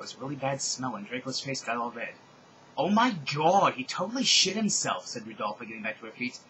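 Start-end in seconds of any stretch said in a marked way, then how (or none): 2.8-5.1 loud, high-pitched shocked disgust; disgusted outburst. Acted. Rodolphe reacting to Draco's accident, loudly grossed out. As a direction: exclaim it in grossed-out disbelief.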